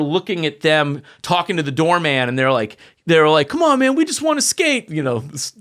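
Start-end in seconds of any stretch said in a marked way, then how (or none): 3.5-4.9 high-pitched mock plea from skaters; imitated pleading. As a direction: mimic kids appealing to a doorman.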